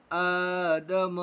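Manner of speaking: आदम with the first आ held long; slowly drawn out. Shown as a demonstration. Teacher drawing out आदम for the class. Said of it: "खींचेंगे".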